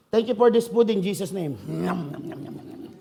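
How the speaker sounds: rushed grace, then soft munching noises; hurried grace then munching. Acted. Lazy believer rushes grace then eats, mock munching. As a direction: rattle off grace and dig in.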